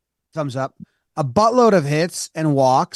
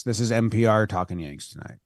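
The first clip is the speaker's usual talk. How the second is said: NPR voice; 6 semitones lower, 5 dB quieter, faster than usual. Pretend. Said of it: "use your NPR voice".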